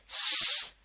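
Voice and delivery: loud high hiss for स; s sound demo. Shown as a demonstration. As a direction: hiss the स hard. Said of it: "क्या sound होती है?".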